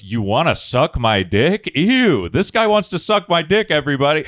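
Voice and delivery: rising, loud mock yell; mocking taunt. P J taunts the husband on the porch, loud mocking yell. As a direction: taunt the neighbor so everyone hears. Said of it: "P J just goes".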